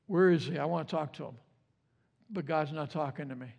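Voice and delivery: low, soft acted lament; quietly forlorn. Job searches for a God who stays silent, quietly forlorn. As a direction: let it sink into subdued, lonely complaint.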